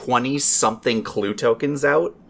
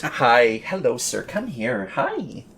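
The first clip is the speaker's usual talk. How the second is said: talking to a cat; slower than usual. Real, not acted. Greeting a cat that wandered in.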